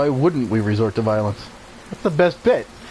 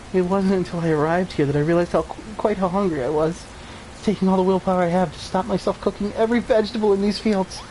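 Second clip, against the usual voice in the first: higher, lightly put-on villager voice; hungry villager; 8 semitones higher than usual. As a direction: sound famished and a bit dazed.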